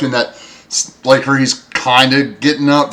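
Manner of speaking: usual speaking voice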